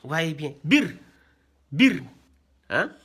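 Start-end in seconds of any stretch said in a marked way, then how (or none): 0.6-2.0 "Bir" with a short, rapid i; brief, quick "Bir"; quick short vowel. Shown as a demonstration. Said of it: "Il faut que ça soit rapide".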